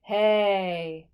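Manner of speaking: loud hey down in the chest. Shown as a demonstration. Teacher shows where chest sits nicely, chest voice. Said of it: "The chest is nice down here".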